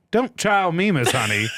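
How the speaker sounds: high, loud southern comeback; sassy retort. A narrator's reading. Glover shoots back at a challenge, sassy retort. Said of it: "he shot back".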